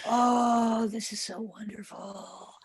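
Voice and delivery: breathy near-whisper of a smug meditator; mock blissed-out meditator. Meditator mock-whispering about being in samadhi, hammed-up. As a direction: gush softly over your own bliss, hushed. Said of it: "if you feel that this meditation is so wonderful".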